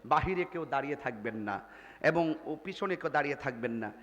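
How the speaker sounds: usual speaking voice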